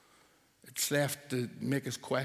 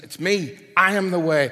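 higher and much louder, each I hammered; emphatic Jesus; 7 semitones higher, 10 dB louder than usual. Retold or imitated. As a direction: proclaim it as Jesus with force. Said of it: "Jesus said".

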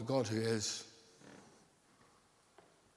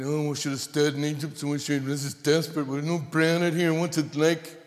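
louder, whining complaint in a put-on voice; mock grumble of the wandering people; grumbling Israelites; 6 dB louder than usual. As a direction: moan like a fed-up crowd in the desert. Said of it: "They grumbled".

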